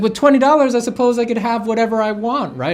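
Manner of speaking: very high, loud, grand customer voice; pompous patron. Retold or imitated. Diner flaunting his twenty dollars to the waiter, pompous. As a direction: lord it over the waiter with your cash.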